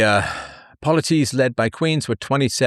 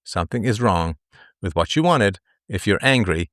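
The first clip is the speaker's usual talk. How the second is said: normal talking voice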